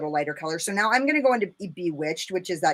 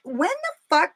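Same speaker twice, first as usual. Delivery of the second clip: much higher, mock outraged squeal; outraged disbelief; 11 semitones higher than usual. Remembering the surprise signature request, squealing disbelief. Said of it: "I was like".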